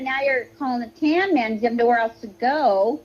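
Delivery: ordinary delivery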